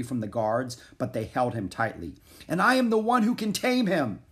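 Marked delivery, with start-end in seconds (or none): 2.5-4.2 high, raised-voice boast; defiant shout. A narrator's reading. Prince struggling against the guards, shouting defiantly. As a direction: cry it out as a defiant young prince. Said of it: "shouted Alexander".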